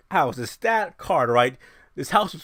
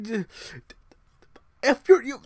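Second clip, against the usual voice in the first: normal talking voice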